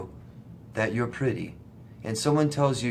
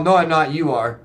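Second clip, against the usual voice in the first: defensive denial; 4 semitones higher, 7 dB louder than usual. Retold or imitated. Narcissist's denial acted out.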